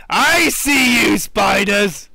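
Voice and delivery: loud taunt to the spiders; shouting taunt. Real, not acted. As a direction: shout it across the room at them. Calls out at spiders.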